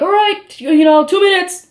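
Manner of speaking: warning the singer there are two minutes left; light mock stagehand voice; producer calling time. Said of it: "they're like".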